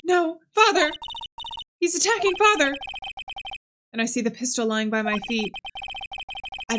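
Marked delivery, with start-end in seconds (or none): 0.0-2.8 put-on frightened child. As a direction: cry out as a scared boy. Child sees father attacked.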